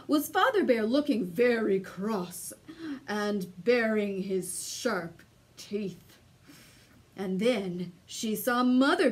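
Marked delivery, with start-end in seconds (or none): none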